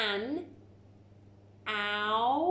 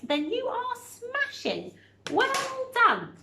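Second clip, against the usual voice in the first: excited praise; 13 semitones higher, 3 dB louder than usual. Real, not acted. Teacher praising pupils' answers.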